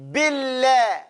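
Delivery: Billah with a light, thin lam; Billah, lam thinned out. Shown as a demonstration. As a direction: keep the lam of Billah skinny. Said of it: "We call this thinning up the lam".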